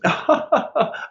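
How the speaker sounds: high, fake polite laugh; imitated high tinkly office laughter; imitated phone laugh. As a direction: put on a sociable laugh for a caller. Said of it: "and she goes".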